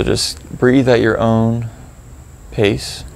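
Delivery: calm, spacious phrases with long pauses; gently prompt, leaving room to notice each feeling; guided meditation. Acted. Guide leading listeners to reflect on procrastination, calm meditation delivery.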